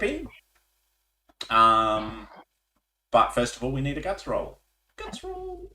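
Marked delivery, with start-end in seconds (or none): 5.0-5.7 very high, soft held notes; playful high echo. Pretend. Game master echoing the call for a guts roll, high and soft.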